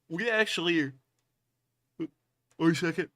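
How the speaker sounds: talking through a yawn; words stretched by a yawn. Real, not acted. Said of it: "I, I yawned".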